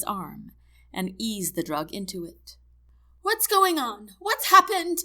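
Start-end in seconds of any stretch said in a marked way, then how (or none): 3.2-5.0 high, loud, panicked questions; hysterical. A narrator's reading. Wife rushes in to her stricken husband, hysterical. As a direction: burst in demanding answers, hysterically. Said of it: "crying, hysterical woman enter the room".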